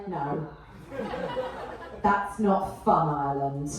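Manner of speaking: low, deflated crowd reply; mock disappointment. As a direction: drop low and let the cheer die.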